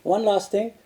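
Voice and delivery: ordinary talk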